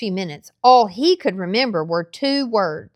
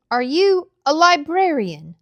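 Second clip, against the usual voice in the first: put-on curious boy; 6 semitones higher, 3 dB louder than usual. A narrator's reading. Boy Mint asks old man.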